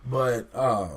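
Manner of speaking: ordinary talk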